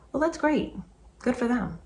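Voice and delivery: high, dry sarcastic brush-off. Real, not acted. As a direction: wave it off sarcastically. Sarcastic reply to commenters.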